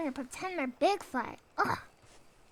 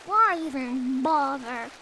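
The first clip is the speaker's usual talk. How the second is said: mock despair; 3 dB louder, slower than usual. Mock gloomy doomer lament.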